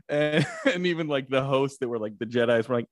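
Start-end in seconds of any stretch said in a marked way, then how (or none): none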